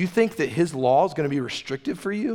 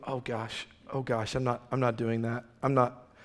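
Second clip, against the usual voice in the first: much softer, half-under-the-breath dismay; mock dismayed mumble; 2 semitones lower, 7 dB quieter than usual. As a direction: mutter it like someone shrinking from a hard rule. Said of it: "All the things that you go".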